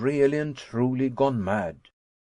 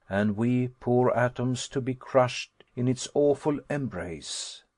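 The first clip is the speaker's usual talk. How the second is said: plain reading voice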